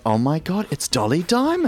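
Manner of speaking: amazed gasp. Retold or imitated. Visitors recognising [name] in clip.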